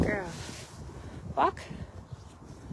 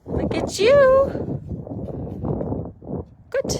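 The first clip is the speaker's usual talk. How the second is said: very high, long drawn-out cooing; delighted high squeal of praise, stretched slow; gushing praise; much slower than usual. Real, not acted. Praising the animal in training.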